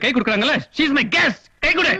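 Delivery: rising in pitch, insistent scolding; irate parental order; angry demand. Acted. Father angrily demands a handshake.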